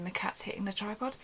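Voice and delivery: soft whisper to the side; whispered note about the cat; whispered aside. Real, not acted.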